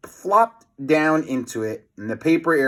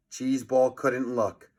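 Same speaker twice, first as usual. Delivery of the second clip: plain reading voice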